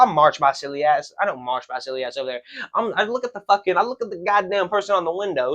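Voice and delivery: ordinary talk, nothing marked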